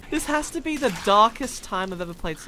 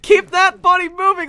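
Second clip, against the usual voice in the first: loud coach cheer; 9 semitones higher, 11 dB louder than usual. Acted. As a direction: shout it like a fitness coach.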